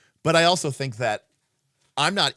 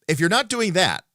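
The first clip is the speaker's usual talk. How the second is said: ordinary talk, nothing marked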